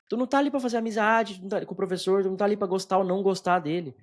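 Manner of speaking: high-pitched indignant challenge; exasperated. Real, not acted. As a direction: argue back, pitch pushed well up. Continues scolding the complaining student.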